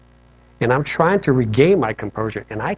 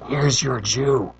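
inner voice; much slower than usual. Retold or imitated. Inner voice speaks to him.

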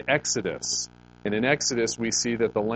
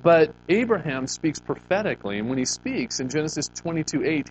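ordinary delivery

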